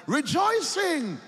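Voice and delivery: drawn-out, high, loud rejoicing; slow ringing exultant cry; joyful shout. Real, not acted. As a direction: sing it out in praise. Preacher exults over baptism.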